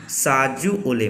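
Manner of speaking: साजू ओले said slowly, each word set apart; slow, with pauses. Shown as a demonstration. Teacher modelling the Kharia phrase for students, slowly. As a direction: ease साजू ओले out gently for repeating. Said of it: "रुक के बोलना है".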